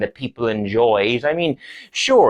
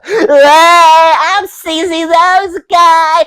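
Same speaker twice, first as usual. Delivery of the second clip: very high, loud, drawn-out character yell; slow, shouted squeal of a silly character; Sneezy Nose Guy; 10 dB louder, much slower than usual. Acted. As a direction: burst into a shrieked catchphrase. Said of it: "a visit from Sneezy Nose Guy right now".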